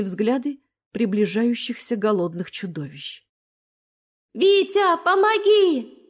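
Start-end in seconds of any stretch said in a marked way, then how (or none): 4.3-6.0 high, loud desperate cry for help; desperate scream. A narrator's reading. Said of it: "отчаянно закричала Дина".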